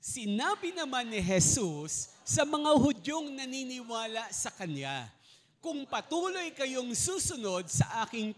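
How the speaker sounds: slow, loud congregational reading aloud; group scripture reading. Real, not acted. Preacher leading the church in reading scripture, slow and loud. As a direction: read the verse together, slow and loud.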